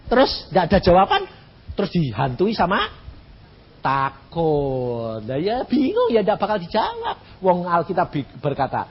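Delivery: ordinary talk, nothing marked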